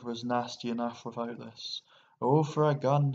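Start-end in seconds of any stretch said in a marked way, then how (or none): 2.2-3.1 louder, wishful outburst; persistent. A narrator's reading. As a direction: keep pleading for it in frustration. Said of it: "he persisted".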